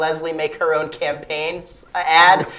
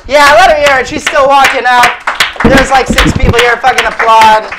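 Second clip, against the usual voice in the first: hyping the crowd; 3 semitones higher, 7 dB louder than usual. Real, not acted. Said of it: "hyping the crowd".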